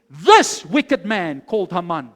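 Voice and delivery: loud, slow, deliberate denunciation; name the villain boldly, weighing each word; bold accusation. Acted. Queen stands to accuse her enemy before the king, boldly. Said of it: "stands up with boldness and she says".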